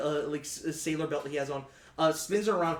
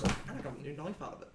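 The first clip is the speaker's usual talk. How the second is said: softer, fast throwaway line; offhand quiet aside; 7 dB quieter, much faster than usual. Acted. Captain shrugging off the crewman's death, soft and fast. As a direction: toss it off casually and quick. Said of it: "and just goes".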